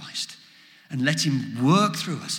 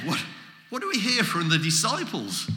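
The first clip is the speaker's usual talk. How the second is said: usual speaking voice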